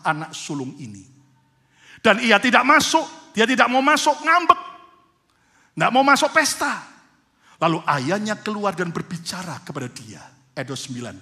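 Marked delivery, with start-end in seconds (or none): 2.0-6.9 loud, high, driving sermon delivery; full-force preaching. Acted. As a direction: preach it with rising fire to the congregation. Preaching the sulking son.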